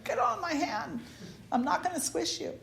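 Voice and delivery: soft, high-pitched reassurance; gentle coaxing. Retold or imitated. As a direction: coax a small creature, softly. Retold reassuring of the cricket.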